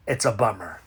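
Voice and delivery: low, soft deadpan mumble; deadpan aside. Acted. Comedian mumbles an aside about a film. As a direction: drop it flat under your breath.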